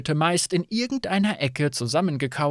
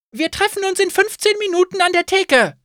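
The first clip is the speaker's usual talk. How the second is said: much higher, louder call over the panic; 6 dB louder than usual. Acted. Innkeeper calling to panicking guests, loud. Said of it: "rief sie".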